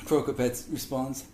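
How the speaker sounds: ordinary delivery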